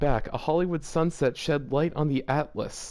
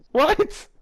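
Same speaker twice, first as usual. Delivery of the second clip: high, loud shocked what, rising; incredulous what; 4 dB louder than usual. Real, not acted. Baffled by the fanfic line, asking a friend in disbelief. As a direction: squeak it out in disbelief.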